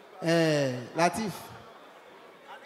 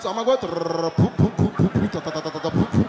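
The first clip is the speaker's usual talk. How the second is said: imitated bodily noises; 8 semitones lower than usual. Imitating peeing and farting sounds.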